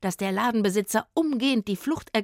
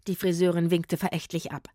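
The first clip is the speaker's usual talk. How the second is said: usual speaking voice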